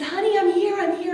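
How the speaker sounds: high, loud, urgent reassurance; urgent calling out. Retold or imitated. Wife rushing to her just-woken husband, urgent calling. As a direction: call out to a loved one, voice leaping up.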